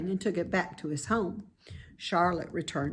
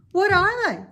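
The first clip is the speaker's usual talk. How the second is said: put-on high, loud young pig asking; an eager little pig wanting to know; 13 semitones higher, 9 dB louder than usual. Wilbur asking Charlotte about webs, high and eager.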